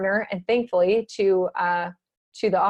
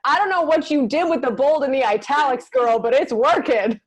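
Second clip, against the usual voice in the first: mock indignant self-quote. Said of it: "And I'm like".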